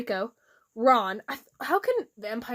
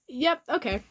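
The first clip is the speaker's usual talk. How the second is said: ordinary talk, nothing marked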